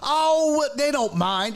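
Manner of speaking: lightly imitated airy dismissal; breezy oblivious aunt. Aunt dismisses the waiting line, breezy and oblivious. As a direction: brush it off with a high "oh". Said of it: "That's how she was".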